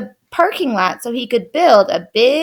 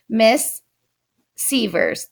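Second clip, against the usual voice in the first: plain reading voice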